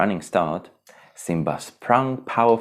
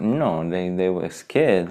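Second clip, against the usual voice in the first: ordinary delivery, nothing marked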